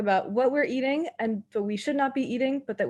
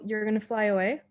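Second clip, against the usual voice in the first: ordinary talk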